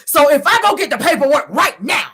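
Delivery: loud, high mock outburst; yelling. Martell threatens to fetch his paperwork, yelling. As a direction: holler like someone hyped and mad. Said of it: "He starts yelling".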